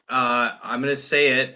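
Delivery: ordinary delivery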